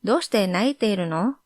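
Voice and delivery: brisk casual question; quick casual repeat. Shown as a demonstration. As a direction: rattle off どうして泣いているの? fast and light. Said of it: "か becomes no".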